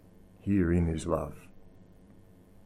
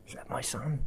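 hopeful disbelief; 3 semitones higher, 10 dB quieter than usual. Acted. Father spots son far off.

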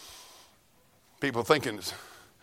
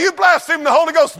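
1 mock accuser; 10 semitones higher, 7 dB louder than usual. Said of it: "You say".

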